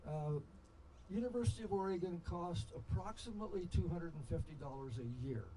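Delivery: ordinary talk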